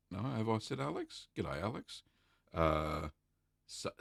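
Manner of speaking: usual speaking voice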